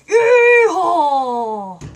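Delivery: loud whooping yeehaw; yeehaw cheer. Acted. Cheering on the puppet with a loud whoop. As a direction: let out a big cowboy whoop.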